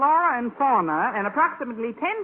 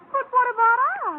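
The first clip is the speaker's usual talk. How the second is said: high, sharp cry of dismay; dismayed protest; 12 semitones higher, 4 dB louder than usual. Acted. Teacher dismayed at missing trip, high protesting cry. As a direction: blurt it out in alarm.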